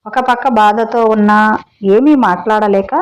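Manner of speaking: ordinary delivery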